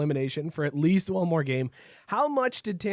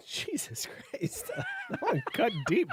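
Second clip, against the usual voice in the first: breathless laugh through the words; cracking up at a brutal burn; laughing in disbelief; 7 dB quieter than usual. Real, not acted. Host laughing at his friend's self-roast.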